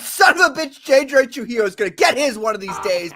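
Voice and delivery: loud and heated, pitched high; angry outburst. Real, not acted. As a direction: blurt it out in a burst of anger. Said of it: "I'm much calmer now".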